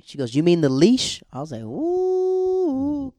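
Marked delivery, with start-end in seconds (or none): 0.3-1.2 loud, high scornful question; mock retort. Acting out a breakup retort. 1.6-3.1 long, loud, high-pitched "ooh"; high hooting "ooh". Real, not acted. Reacting to a cutting comeback.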